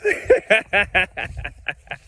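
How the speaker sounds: a short, high burst of laughter; amused laugh. Real, not acted. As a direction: laugh out loud at your own point. Laughs at own remark.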